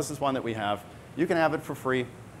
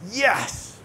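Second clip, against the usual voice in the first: mock triumph; 6 semitones higher than usual. Mock triumph over trivial milestone.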